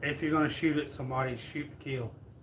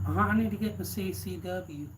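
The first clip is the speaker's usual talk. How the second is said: higher-pitched old lady impression; mimicking an old woman making a request; 5 semitones higher than usual. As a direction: act out an elderly customer, pitched up. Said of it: "the old woman".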